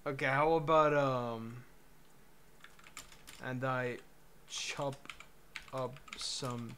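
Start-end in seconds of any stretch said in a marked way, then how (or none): none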